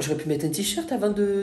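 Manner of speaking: ordinary delivery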